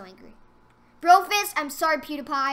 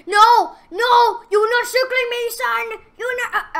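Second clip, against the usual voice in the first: alarmed shout; 9 semitones higher, 12 dB louder than usual. Real, not acted. Enemy circling him in game.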